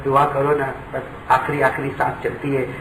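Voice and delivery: a preacher softly describing a father's last breaths; hushed grief. Real, not acted. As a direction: drop to a grieving hush. Hushed about dying father.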